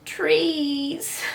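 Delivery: high, breathy sob of a word; sobbed word. Acted. Boy sobbing an answer to the doctor, high and breathy. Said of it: "sobbed Mickey".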